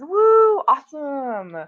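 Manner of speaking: loud, high whoop of congratulation; excited cheer. Real, not acted. Streamer celebrating a viewer's word count, excited cheer.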